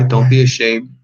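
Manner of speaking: ordinary delivery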